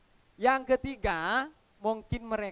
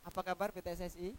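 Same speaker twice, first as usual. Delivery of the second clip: soft greeting; 5 semitones lower, 11 dB quieter, faster than usual. Shown as a demonstration. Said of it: "Ikuti suasana ataupun intonasi saya".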